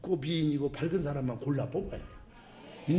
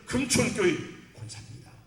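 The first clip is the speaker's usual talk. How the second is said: proud self-introduction; 7 semitones higher than usual. Retold or imitated. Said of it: "누가 만나면".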